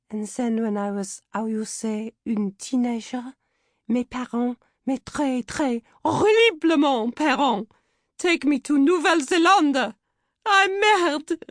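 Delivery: hammed-up French actress accent, rising high and loud; husky French accent. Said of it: "I tried a husky French accent".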